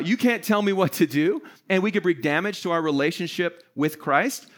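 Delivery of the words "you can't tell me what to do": mock defiance, pitched a bit up; defiant. Believer acts out resisting God's list, mildly defiant. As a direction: play someone resisting orders. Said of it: "We can resist God".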